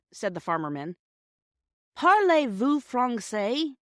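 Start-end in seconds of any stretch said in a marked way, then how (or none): 2.0-3.8 slow, bold and pitched up, a touch louder; a schoolboy proudly trying out his French. A narrator's reading. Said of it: "began Peter boldly".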